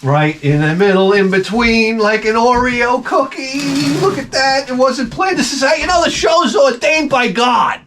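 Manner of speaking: excited marvel. Real, not acted. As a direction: build from wonder to a triumphant cry. Gift falls exactly in middle.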